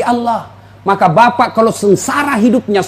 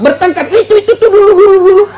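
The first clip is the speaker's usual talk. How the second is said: laughing excitement; 11 semitones higher, 4 dB louder, faster than usual. Acted. Laughing about old quarrels.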